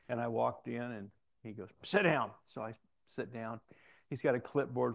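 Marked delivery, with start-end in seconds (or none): 1.8-2.3 curt order, imitated. Captain's order acted in a story.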